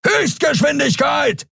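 slow, high roared order; drawn-out, high-pitched shouted command; bellowed command. A narrator's reading. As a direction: bellow it the length of the ship. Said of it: "brüllte er quer durch das Schiff".

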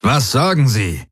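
mit erhöhter Stimme drängend fragen; dränge auf eine Antwort. A narrator's reading. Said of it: "drängte Darayos". (drängende Nachfrage)

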